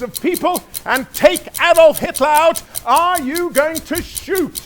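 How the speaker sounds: high, building to loud insistent questions; urgent pressing demand. Acted. Urgent countdown to shoot Hitler.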